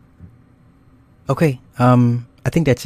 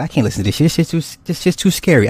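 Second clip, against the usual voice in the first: higher, mock-spooked protest; retold mild alarm; 7 semitones higher, faster than usual. Host retelling being spooked by a story at night, mock alarm.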